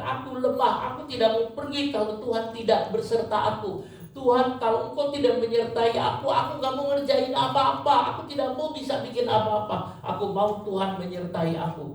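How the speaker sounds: preacher as Moses begging God to go with him; desperate Moses. Acted. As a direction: cry out to God as a frightened, weak man. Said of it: "Musa mengatakan".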